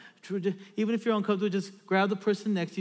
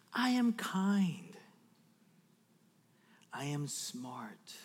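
slow, softer declaration; child's affirmation; 4 semitones lower, 5 dB quieter, much slower than usual. Retold or imitated. Little daughter says things about herself, slow soft affirmation. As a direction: lightly imitate a child, drawn out. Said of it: "She'll say things like".

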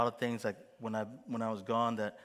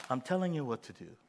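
ordinary delivery

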